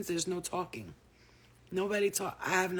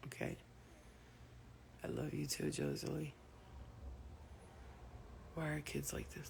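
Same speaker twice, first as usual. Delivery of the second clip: whisper; 12 dB quieter than usual. Real, not acted. Whispering while child naps.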